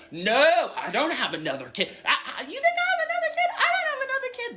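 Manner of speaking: rising into a very high, laughing squeal; mocking dad impression. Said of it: "That's how he would talk".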